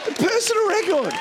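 high, excited exclamation; triumphant boast. Real, not acted. Pastor announcing his record from despair, high triumphant boast. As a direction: crow it to the crowd.

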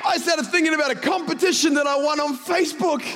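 high, urgent, sustained sermon delivery; impassioned preaching. Real, not acted. Pastor warning that the devil trades in darkness, impassioned preaching. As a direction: drive the point home to the congregation.

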